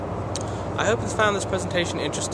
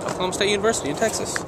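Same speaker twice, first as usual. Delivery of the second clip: rushed; 8 semitones higher, 3 dB louder, much faster than usual. Shown as a demonstration. Rushed retake.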